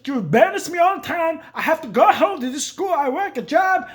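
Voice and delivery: high, loud mock shouting father; hammed-up furious dad at home; dad yelling at his son back home. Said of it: "But when we got home".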